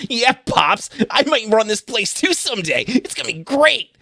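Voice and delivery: a smug small son answering his dad; scrawny son replying to his father, grating. Acted. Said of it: "The voice itself is grating".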